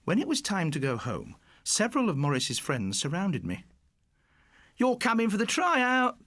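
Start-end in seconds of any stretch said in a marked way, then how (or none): 4.8-6.2 high, loud gang shout. Acted. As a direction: call it out to him, much higher. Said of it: "they shouted".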